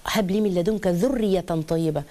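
slow, reverent recitation of the verse; slow Quran recitation. Acted. Preacher to mothers, reciting the verse slowly.

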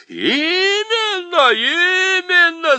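very high, slow wail; howling chorus. A narrator's reading. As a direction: bawl it out as a crowd. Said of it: "завопил хор". (очень высокий протяжный вопль хора)

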